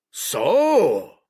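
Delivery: loud, high exclamation; a sudden sharp cry; old sailor crying out loud; cried out. A narrator's reading. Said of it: "cried Silver".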